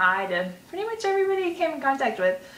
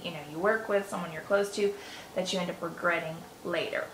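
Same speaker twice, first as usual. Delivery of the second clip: usual speaking voice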